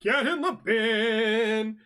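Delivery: high, loud, very slow catchphrase yell; big hammed-up holler; drawn-out yell. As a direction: stretch it out like a show catchphrase. Performed catchphrase, drawn out loudly.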